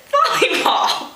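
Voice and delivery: ordinary delivery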